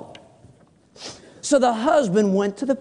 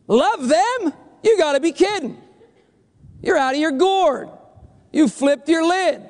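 incredulous; 8 semitones higher than usual. Acted. Acted refusal to love enemies.

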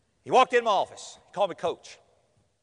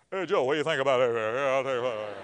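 low, put-on man's voice; mock deacon; 8 semitones lower than usual. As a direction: parody an old deacon chatting over a baby. Deacons mock-cooing over a baby.

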